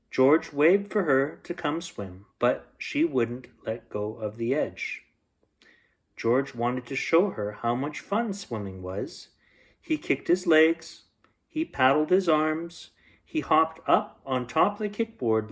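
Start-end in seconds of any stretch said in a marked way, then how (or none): none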